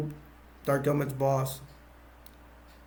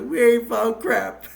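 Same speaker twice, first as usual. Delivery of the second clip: sassy answer; 8 semitones higher, 6 dB louder, slower than usual. Retold or imitated.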